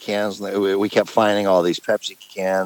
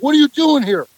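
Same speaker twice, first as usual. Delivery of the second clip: mock cop yell; 5 dB louder than usual. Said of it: "we're like".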